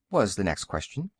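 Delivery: plain reading voice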